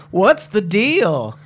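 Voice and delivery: high, loud, drawn-out stand-up comic voice; Seinfeld. Retold or imitated. Client asking the manager the deal, in a Seinfeld voice. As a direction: stretch it slowly, pitch up, comedian's delivery. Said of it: "doing it in a Seinfeld voice".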